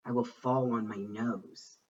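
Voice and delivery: ordinary delivery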